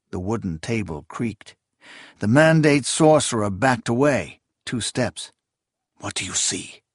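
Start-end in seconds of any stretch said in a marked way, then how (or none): 6.0-6.8 softer, lower hissed question; tense hissed demand. A narrator's reading. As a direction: hiss it urgently under your breath. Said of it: "Zarias hissed".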